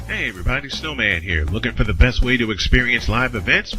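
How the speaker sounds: ordinary delivery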